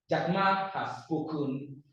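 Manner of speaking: usual speaking voice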